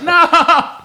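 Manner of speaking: hammed-up high-pitched refusal; mock protest. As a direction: pretend panic at losing the pillow. Refusing pillow removal, laughing.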